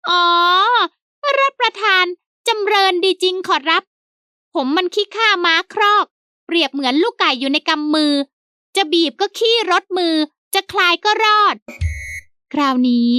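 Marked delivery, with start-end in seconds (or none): 0.1-11.5 high servant voice, peeved; sulky high servant. A narrator's reading. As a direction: grumble it up high like the offended servant. Servant Haew self-pitying.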